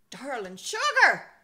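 wife wailing from the upstairs window; mock wail. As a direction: cry out after him from the window. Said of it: "wailed Lil".

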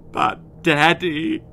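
high quavering whimper; trembling. Acted. Daughter to her father, trembling. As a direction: let the voice tremble. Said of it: "trembled the wet-eyed Wen".

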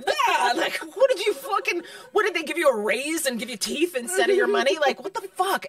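high, loud comic indignation; mock outrage. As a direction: rant in hammed-up disbelief, laughter close by. Mock outrage at an actor's teeth.

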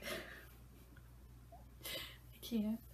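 ordinary talk

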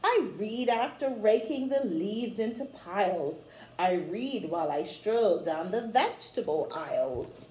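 rhythmic read-aloud of a rhyming picture book; storybook reading. Acted. Teacher reading a rhyming book to children, read-aloud style. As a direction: read the rhymes to children with a gentle lilt.

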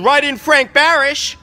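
sudden high, loud slow yell. Real, not acted. Candidate yells his own name at the voters, loud. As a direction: blast the name out. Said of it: "I'm sorry to yell at you folks".

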